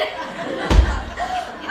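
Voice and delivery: high laugh. Acted. Laughing at own joke.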